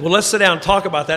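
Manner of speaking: put-on the old sponsor thrilled to be asked; excited. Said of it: "And he got excited".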